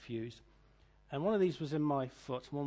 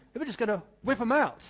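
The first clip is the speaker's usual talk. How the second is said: louder, higher, mock insistent; emphatic repeat; 6 semitones higher, 8 dB louder than usual. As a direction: spell it out loudly for a disbelieving patient. Staff repeat it to disbelieving patient.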